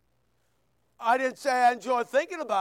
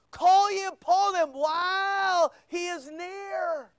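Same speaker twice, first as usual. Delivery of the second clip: preacher's pleading cry, raised high; 5 semitones higher than usual. Real, not acted. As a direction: cry the verse out to the congregation. Preacher crying out the scripture.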